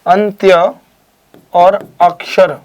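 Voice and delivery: अंत्य and अक्षर drawn out separately with pauses; word parts shown. Shown as a demonstration. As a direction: slowly set अंत्य apart from अक्षर. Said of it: "अब देखिए यहाँ पर". (अंत्य और अक्षर अलग-अलग, धीरे-धीरे)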